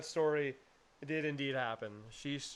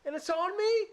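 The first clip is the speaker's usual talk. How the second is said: loud, high mock horror; panicked high yelp of a question; freaking out; 5 dB louder than usual. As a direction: imitate a partner shrieking in disgust. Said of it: "she's freaking out too".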